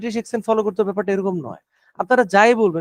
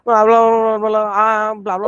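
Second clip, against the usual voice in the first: mock rushed babble; 3 dB louder, faster than usual. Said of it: "আপনি অনেক দ্রুত কথা বলছিলেন".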